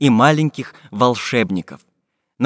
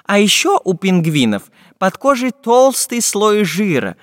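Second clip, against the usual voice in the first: lightly voiced professor, higher-pitched and emphatic; learned professor explaining; 6 semitones higher than usual. Professor tells the travellers about Antarctica, higher-pitched lecturing. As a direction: explain it keenly in a slightly put-on scholar's voice.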